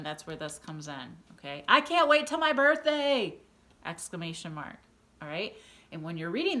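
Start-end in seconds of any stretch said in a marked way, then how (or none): 1.7-3.4 high, loud, eager shout; excited. Shown as a demonstration. Teacher showing pupils how to read an exclamation, excited. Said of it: "change your voice to show that excitement".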